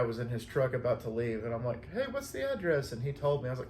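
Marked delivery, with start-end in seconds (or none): 1.9-2.9 high-pitched friendly hail; calling out. Retold or imitated. Calling to a guy leaving in his truck, high hail. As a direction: call over to someone about to leave.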